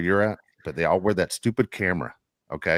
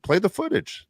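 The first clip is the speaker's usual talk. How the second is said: high, mocking dare, pitched way up; mock-bold high dare; defiant challenge; 12 semitones higher than usual. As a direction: taunt the biker to play the tape. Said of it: "I go".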